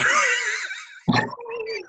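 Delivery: whooping burst of laughter; big laugh. Real, not acted. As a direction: howl with laughter at the punchline. Laughs at potato punchline.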